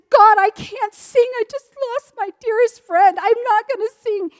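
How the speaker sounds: high, loud protest in distress; distraught protest. Retold or imitated. Widow refusing God's call to sing, distraught. Retold protest to God, pleading.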